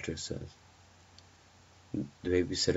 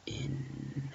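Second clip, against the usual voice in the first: hushed, spooky whisper; whispered reveal; 6 semitones lower than usual. A narrator's reading. Narrator ending a scary story on its reveal, whispered. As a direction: whisper the last words for a chill.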